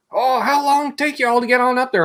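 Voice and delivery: put-on a guard asking where they're headed, in a Southern accent. Security guard asks the Canadians where they're headed, in a Southern accent. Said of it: "He's like".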